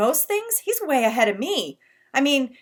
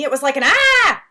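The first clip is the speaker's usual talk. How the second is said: demonstrated "ah"; 5 semitones higher, 12 dB louder than usual. Acted. Boy demonstrates his cry.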